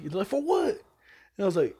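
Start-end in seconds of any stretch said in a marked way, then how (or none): 0.3-0.7 high incredulous question; incredulous coworkers. Retold or imitated. Voicing coworkers doubting his Uzi plan, high incredulous question. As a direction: ask it in disbelief.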